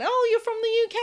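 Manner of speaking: a high mock gush of someone hearing a British accent; mock foreigner. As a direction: chirp it like a surprised stranger abroad. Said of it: "Because people hear my accent".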